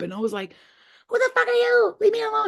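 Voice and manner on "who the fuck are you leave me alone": very high, squeaky protest; elf voice. Retold or imitated. Little elf telling off the alien, high squeaky voice. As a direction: squeal it like a frightened tiny elf. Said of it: "I was talking like an elf".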